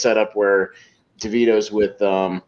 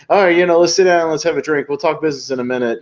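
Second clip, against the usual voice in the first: lightly acted mobster small talk, starting high and loud; breezy put-on chit chat, easing down; casual chit chat; 6 semitones higher, 3 dB louder than usual. Mobster inviting associates to sit for a drink, mock casual chit chat. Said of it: "just making chit chat".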